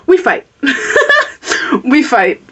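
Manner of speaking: laughing mid-sentence. Real, not acted. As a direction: laugh through the words.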